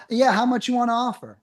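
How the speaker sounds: lightly voiced seller asking how much; seller asking price. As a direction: play the pushy seller asking for a number. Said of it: "That's my intro".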